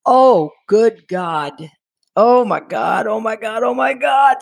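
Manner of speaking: slow stunned gasp building to a shout; rising panic. Retold or imitated. Wife reeling from the tumor news, panic building to a shout. Hearing of husband's brain tumor.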